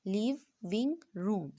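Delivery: लिविंग रूम said slowly, syllable by syllable; slow syllable split of लिविंग रूम for children. Shown as a demonstration. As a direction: pull लिविंग रूम apart into its three syllables. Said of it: "इसकी भी three है".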